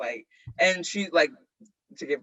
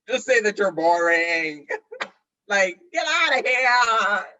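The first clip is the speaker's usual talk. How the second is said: laughing; 4 semitones higher, 6 dB louder than usual. Real, not acted. Mocking the mom, laughing.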